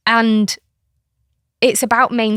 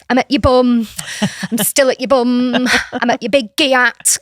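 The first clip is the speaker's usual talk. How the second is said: loud, higher playful child imitation; teasing child; 4 semitones higher, 6 dB louder than usual. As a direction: imitate a kid gleefully teasing mum. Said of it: "she goes".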